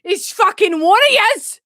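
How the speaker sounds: high, shouted Scottish outrage; Scottish accent. Acted. Mimicking the Vine just played, shouted Scottish accent. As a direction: yell it in broad Scottish, dragging out the last word. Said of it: "why I say it like that".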